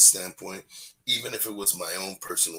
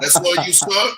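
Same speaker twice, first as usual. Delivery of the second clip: mimicking the boss; 10 semitones higher, 10 dB louder than usual. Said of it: "she was like".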